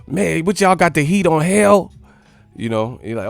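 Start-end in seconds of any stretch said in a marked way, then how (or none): 0.1-1.9 complaining guest. Retold or imitated. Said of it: "they be like".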